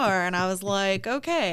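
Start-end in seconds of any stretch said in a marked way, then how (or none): 1.1-1.5 put-on light French accent, higher pitch; a quick French-accented okay. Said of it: "said that in a French accent".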